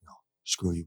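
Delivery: imitated as a whisper; whispered retort. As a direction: hiss the refusal under the breath, retold. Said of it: "He's like".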